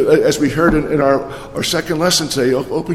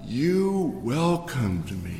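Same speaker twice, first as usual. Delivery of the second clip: normal talking voice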